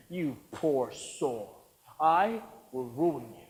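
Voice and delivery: soft slow menacing threat; quiet menace. Acted. Producer quietly threatening the actor. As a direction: drop low and slow to threaten.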